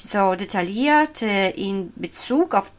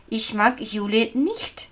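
normal talking voice

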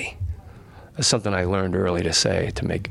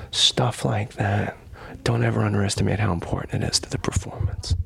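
hushed advice. Retold or imitated. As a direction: lean in and pass on a secret. Tom Cruise giving quiet advice.

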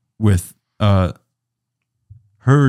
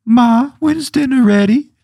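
put-on higher, slow child's voice calling out; a child calling from another room, drawn out; kid calling; 4 dB louder, slower than usual. Kid calls to his mother from the background, in a high slow voice. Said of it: "From the background".

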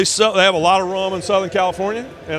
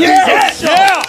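excited shout; 12 semitones higher, 7 dB louder than usual. Real, not acted. Guest cheering as he joins.

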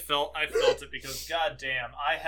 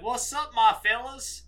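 put-on thick Southern accent; 8 semitones higher, 4 dB louder than usual. Telling cohost how they greeted people as a kid, thick Southern accent. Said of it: "I had the thickest accent".

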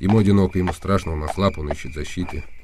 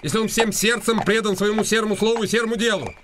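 town crier. Acted. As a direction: call it out like a herald on the square. Continues mock crier tone. (высоко и протяжно, как глашатай на площади)

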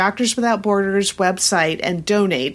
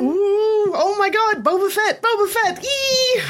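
very high, squealing fangirl delight; thrilled squeal; 13 semitones higher than usual. Real, not acted. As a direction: squeal like a fan who just saw her hero. Fan thrilled about Boba Fett.

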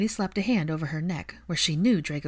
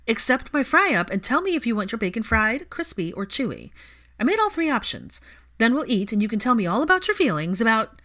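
bossy insistence; 5 semitones higher, 5 dB louder than usual. Acted. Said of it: "Ginny's brown eyes turned dangerous".

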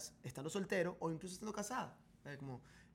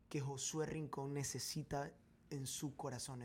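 ordinary delivery

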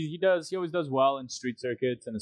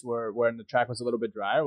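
ordinary delivery

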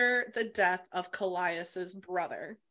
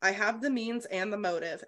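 ordinary talk, nothing marked

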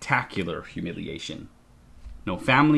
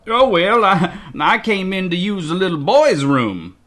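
flustered, higher stammering excuse; embarrassed stammer; 9 semitones higher, 6 dB louder than usual. Acted. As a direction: fumble for a lame excuse. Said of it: "says with an embarrassed".

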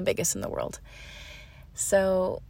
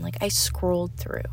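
normal talking voice

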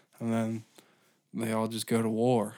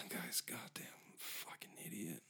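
whisper; 5 semitones lower, 24 dB quieter than usual. Real, not acted. Muttered aside under breath.

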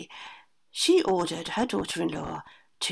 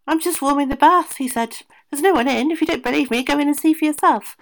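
higher, much louder cheerful attendant; bath attendant; 7 semitones higher, 9 dB louder than usual. A narrator's reading. Son disguised as attendant fooling the ogre, loud and innocent.

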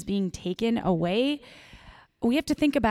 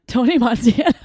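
loud mock movie-gangster accent; loud, playful fake accent; Tony Montana; 3 semitones higher, 9 dB louder than usual. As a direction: blurt it in a hammed-up film accent. Joking attempt at a requested accent.

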